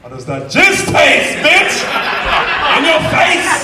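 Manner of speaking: slow sneer up to a high yell; gloating taunt. Retold or imitated. Teller taunts his friend after revenge, gloating yell. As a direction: rub it in his face.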